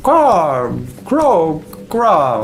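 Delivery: high croaking raven call; cartoon raven cawing. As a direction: croak it like a bird. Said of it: "And he says".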